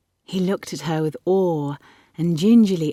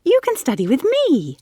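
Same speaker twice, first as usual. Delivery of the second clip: put-on high, eager girl; excited, pitched well up; crackling with excitement; 9 semitones higher, 4 dB louder than usual. A narrator's reading. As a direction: burst out with a thrilling idea. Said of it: "She fairly crackled with excitement".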